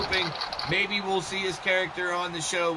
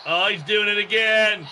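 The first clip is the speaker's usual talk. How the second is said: loud exclamation; excited alarm; 3 semitones higher, 7 dB louder than usual. Real, not acted. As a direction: call out loudly as it recurs. Reacting to the glitch recurring.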